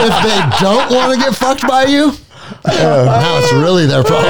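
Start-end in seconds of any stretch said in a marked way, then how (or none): 0.0-2.1 laughing. Real, not acted. Laughing mid-joke.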